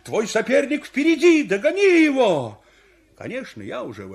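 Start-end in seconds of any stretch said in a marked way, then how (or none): 0.0-2.6 high, loud race-side yelling; excited spectator shouts; crowd shouting. Acted. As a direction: urge the runner on at full voice. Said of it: "мне всё кричат".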